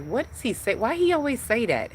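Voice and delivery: high-pitched baffled whine, retold; puzzled complaint. Retells own puzzled reaction to father.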